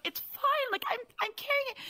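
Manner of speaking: very high, quick mock insistence; retold protest. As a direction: brush them off in a high voice. Retold protest to alarmed coworkers.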